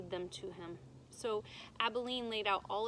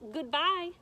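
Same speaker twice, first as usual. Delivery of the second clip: loud, high farewell call; calling goodbye; 6 semitones higher, 7 dB louder than usual. Acted. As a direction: call it out across the water. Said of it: "she called".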